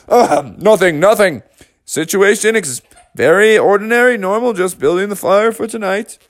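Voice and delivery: feigned innocence. Acted. Bears hide their scheme innocently.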